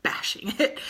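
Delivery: hushed whisper, begging them to stop; whispering stop bashing it; whispered plea. Retold or imitated. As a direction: mouth it softly like a secret. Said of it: "it was very hard for me to be like".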